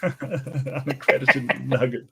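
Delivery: slow, amused echo through a laugh; chuckling unaccredited nugget; laughing repeat. Real, not acted. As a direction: savor the silly phrase. Repeating the joke.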